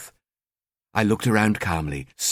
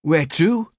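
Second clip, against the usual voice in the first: slightly panicked; 7 semitones higher than usual. Acted. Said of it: "She sounded slightly panicked".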